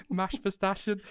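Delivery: put-on high-pitched toddler-style "Max Verstappen", said as "Mash Vestashen"; amused, high imitation of a child's "Max Verstappen" as "Mash Vestashen"; imitating toddler nephew. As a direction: squeak "Max Verstappen" with sh sounds, mimicking a three-year-old. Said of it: "he's three, bless him".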